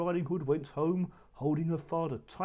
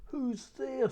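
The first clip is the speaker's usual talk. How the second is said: high put-on granny voice from the wolf; the wolf pretends to be the old grandmother; a wolf mimicking Grandmother, high and sweet; wolf faking Grandmother; 11 semitones higher, 3 dB louder than usual. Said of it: "trying to talk in Grandmother's voice".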